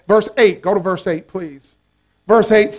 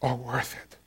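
hushed refrain; 12 semitones lower, 17 dB quieter than usual. Real, not acted. Soft refrain.

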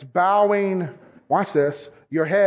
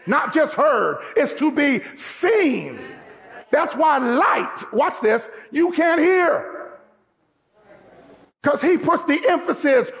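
raised, driving sermon voice; preaching at full force; 6 semitones higher than usual. Acted. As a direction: push the call to action like a revival preacher.

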